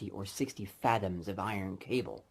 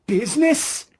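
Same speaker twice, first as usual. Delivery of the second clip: much higher, louder outraged cry; anguished cry; 14 dB louder than usual. Acted. Ghost crying out at Scrooge in anguish. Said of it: "cried the ghost".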